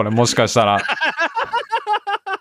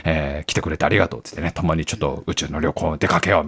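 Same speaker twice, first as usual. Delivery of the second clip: a low, softer take on Bezos as gracious host; Bezos' low voice; 9 semitones lower, 6 dB quieter than usual. Retold or imitated. Bezos warmly welcoming the guest, low and soft. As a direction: pitch him lower and softer, the billionaire host. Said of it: "みたいな".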